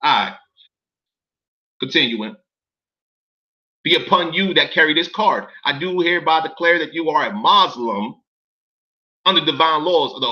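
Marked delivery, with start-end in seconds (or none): none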